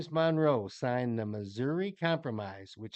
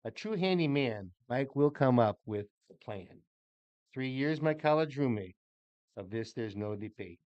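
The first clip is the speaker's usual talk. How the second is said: poem reading. Acted. Reads birthday poem aloud.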